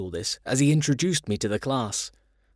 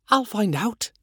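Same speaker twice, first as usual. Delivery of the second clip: ladybird voice; 9 semitones higher than usual. A narrator's reading. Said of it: "said the ladybird".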